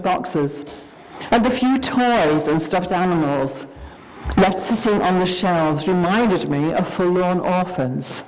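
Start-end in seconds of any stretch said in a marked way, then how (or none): none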